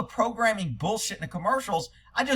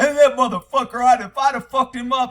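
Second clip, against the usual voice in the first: loud, raised-pitch mock bluster; imitated tough talk; 6 semitones higher, 8 dB louder than usual. Driver leaving a traffic stop, mock angry boasting. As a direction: talk big like someone safely driving away. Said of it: "people always talk shit when they leave".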